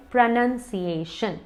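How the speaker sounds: प्रोनाउंसिएशन with "nun", not "noun"; measured प्रोनाउंसिएशन, syllable by syllable; correct pronunciation. Shown as a demonstration. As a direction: say प्रोनाउंसिएशन as "pro-nun-si-ay-shun". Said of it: "इसको कहने का तरीका है".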